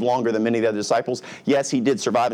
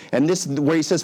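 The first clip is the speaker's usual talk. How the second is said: ordinary talk, nothing marked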